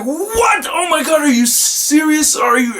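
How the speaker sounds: high, shocked cry of disbelief at missing him. Retold or imitated. Telling how he reacted to his wife's news, high startled disbelief. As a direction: yelp it out, stunned that he walked right by. Retold reaction to missed celebrity.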